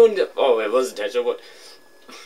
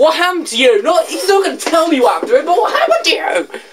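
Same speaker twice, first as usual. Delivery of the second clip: much higher, louder, teasing squeal; high laughing squeal of teasing disbelief; excited teasing; 13 semitones higher, 4 dB louder than usual. Real, not acted.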